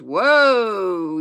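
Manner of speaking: loud, long-drawn holler; yelled halt. A narrator's reading. Boy yelling at runaway calves, long loud halt. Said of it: "yelled Almanzo".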